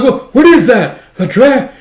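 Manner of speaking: high, loud frightened cry; panicked alarm. Acted. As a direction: stammer it out in terror. Soldier sees dragon.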